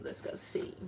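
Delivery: whispered under her breath. Real, not acted. Reader trailing off to herself over the cards, whispered. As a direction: let it drop to a whisper. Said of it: "repeats the phrase, fading".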